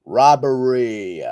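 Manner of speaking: "Robbery" loud and stretched slowly; drawn-out ROBBERY. Real, not acted. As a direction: draw out the last syllable of "Robbery". Repeats the word, drawn out.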